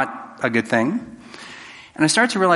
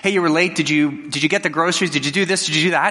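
higher-pitched volley of nagging questions; in-your-face nagging; 4 semitones higher than usual. Acted. Partner pouncing as you walk in the door, mock badgering. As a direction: pepper them with mock accusing questions. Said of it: "a partner who just gets up in your face".

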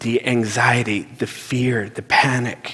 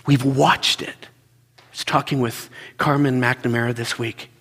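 normal talking voice

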